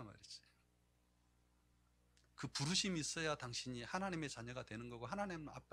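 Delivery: ordinary talk, nothing marked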